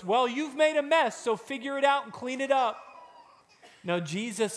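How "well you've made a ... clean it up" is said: higher, louder mock brush-off; dismissive voice. Preacher mocking a careless brush-off. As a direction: toss it off like you don't care. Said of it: "Jesus doesn't say to us".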